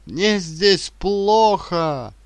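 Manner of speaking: slow, drawn-out desperate cry; shout. A narrator's reading. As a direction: wail it out loud and high. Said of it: "кричит Янис". (протяжный отчаянный крик)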